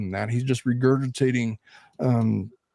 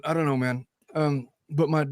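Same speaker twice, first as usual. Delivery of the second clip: ordinary talk, nothing marked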